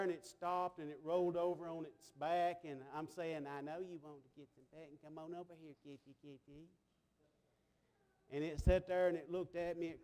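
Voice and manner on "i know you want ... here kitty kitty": very soft, low call to a cat; hushed kitty-calling; soft coaxing. Retold or imitated. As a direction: gently coax a shy cat over.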